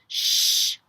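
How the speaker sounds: long breathy shushing sound; hushing shh. Pretend. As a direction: shush the children for a sleeping bear. Hushing so polar bear sleeps.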